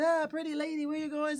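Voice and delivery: high mock Italian-accent catcall; lilting Italian-accent come-on. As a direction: mimic the Italian flirt. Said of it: "talk to me with this accent".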